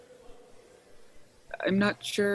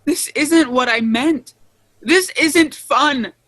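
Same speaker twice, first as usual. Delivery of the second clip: loud, high angry protest; angry yelling; 4 semitones higher, 6 dB louder than usual. Acted. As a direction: yell it in protest. Yelling at Eve.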